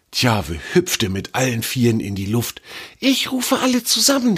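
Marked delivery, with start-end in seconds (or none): 3.0-4.4 much higher, eager shout of joy; enthusiastic call. Acted. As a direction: announce it bursting with excitement. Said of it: "rief er begeistert".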